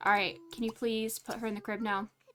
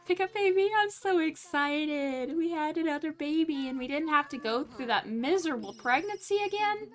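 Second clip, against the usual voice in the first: excited sim voice; 5 semitones higher than usual. Pretend. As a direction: gush like a thrilled new mom. Voicing the mom's joy.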